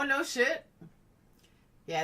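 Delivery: ordinary talk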